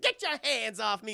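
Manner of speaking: acted indignant protest; very upset. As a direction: snap it like someone being grabbed. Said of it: "He's very upset".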